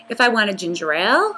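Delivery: normal talking voice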